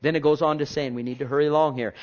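ordinary delivery

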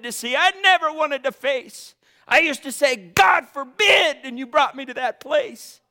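high, loud preacher crying out to God; impassioned preaching prayer. Real, not acted. Preacher telling God where he was brought, impassioned and high.

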